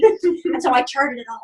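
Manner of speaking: normal talking voice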